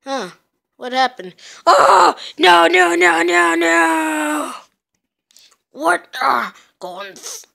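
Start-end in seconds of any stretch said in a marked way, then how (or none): 1.7-4.5 loud frantic no; panicked shout. Acted. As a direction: scream it in panic. Character caught, panics.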